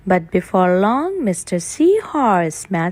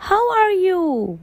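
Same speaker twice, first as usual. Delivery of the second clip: character voice; 9 semitones higher than usual. Acted. Greeting, high pitch.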